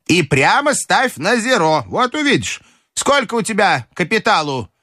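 high, bossy grandmother; бабушка наставляет. Acted. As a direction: instruct him in a high old-lady voice. Grandmother advises and questions. (высокий наставительный голос бабушки)

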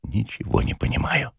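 baffled policeman murmuring to Zosya; quiet. A narrator's reading. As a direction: say it quietly, puzzled. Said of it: "тихо сказал Ежевикин".

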